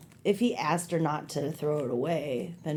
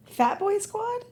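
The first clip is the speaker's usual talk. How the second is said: high, slow questioning mom; slower than usual. Pretend. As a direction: play the puzzled mother, pitched up. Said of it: "Yes, Mom".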